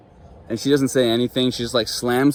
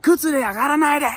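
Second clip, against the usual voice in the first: high mock scolding in Japanese; imitating yelling lady. Vlogger imitating the bathhouse lady, high scolding voice. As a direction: squeak out the bathhouse lady's scolding. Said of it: "just yells at me".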